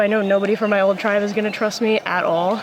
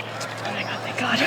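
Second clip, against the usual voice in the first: relieved whisper; 9 dB quieter than usual. Real, not acted. Relief on finding the idol.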